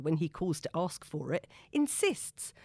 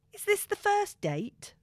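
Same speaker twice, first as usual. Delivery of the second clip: Donny's voice; 4 dB louder, slower than usual. A narrator's reading. Donny asking in his voice.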